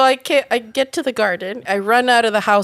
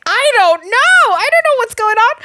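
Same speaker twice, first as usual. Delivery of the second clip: high bewildered protest; 10 semitones higher, 5 dB louder than usual. Retold or imitated. Answering flatmates about the guy, in high loud bewilderment. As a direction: squeak out total confusion.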